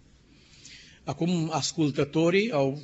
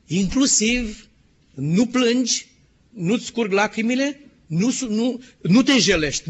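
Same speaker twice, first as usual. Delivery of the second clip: louder, higher, each "nu" pressed hard; emphatic command; 5 semitones higher, 5 dB louder than usual. Acted. God forbidding Ezekiel to mourn, loud and emphatic. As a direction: forbid it firmly, word by word.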